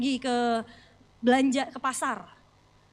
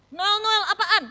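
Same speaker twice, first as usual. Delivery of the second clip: mock angry; 6 semitones higher, 6 dB louder, slower than usual. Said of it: "saya langsung marah".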